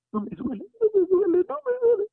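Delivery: retold crying. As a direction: imitate her sobbing relief, rising high and loud. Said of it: "se puso a llorar".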